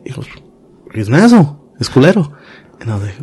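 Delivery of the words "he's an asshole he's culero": sharp, raised retort, higher and louder; angry outburst. Retold or imitated. Worker venting about his cruel boss, angry. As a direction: spit out the insult about the boss.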